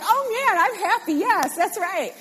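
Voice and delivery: high, loud mock delight; gushing, happy churchgoer voice; excited listener. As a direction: play the thrilled churchgoer. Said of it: "you're like".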